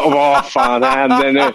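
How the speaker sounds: high, slow alarmed question; startled what now; mock panic. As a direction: act out the panic at the check. Panic at airport check.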